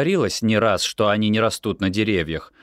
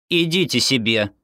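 grumbling crossly; 4 semitones higher than usual. A narrator's reading. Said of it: "сердито буркнула мама".

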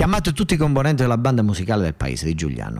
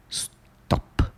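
whispered plop sound; 3 dB quieter than usual. Retold or imitated. Narrator imitates bird dropping sound.

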